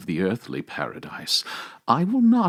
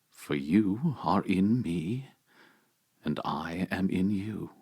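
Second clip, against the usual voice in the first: ordinary talk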